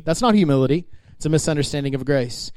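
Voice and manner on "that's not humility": ordinary talk, nothing marked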